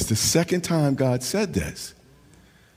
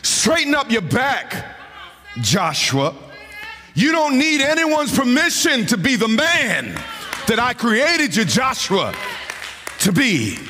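full-force preaching; 10 semitones higher than usual. Acted. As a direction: chant it out over the organ, building hard. Preacher builds to whooping climax.

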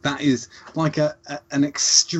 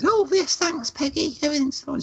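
put-on much higher, polite little old lady reply; imitating Dorothy. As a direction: answer sweetly like a proper elderly neighbour. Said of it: "And she said".

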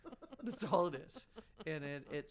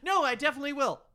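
firm, louder mock insistence; higher, emphatic imitation with a laugh; imitating Alex; 6 semitones higher, 9 dB louder, faster than usual. Teasing a friend's habit of backtracking, mock insistence. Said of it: "Alex's second most used word".

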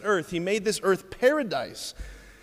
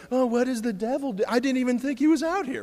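high-pitched, feigned startled voice; hammed-up bewilderment at an ambush; mock surprise; 7 semitones higher than usual. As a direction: play caught off guard, higher and silly. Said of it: "he just gets ambushed by the enemy".

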